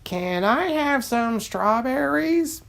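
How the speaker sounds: a hint of a timid duck, asking politely; cartoon duck voice. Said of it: "David the duck".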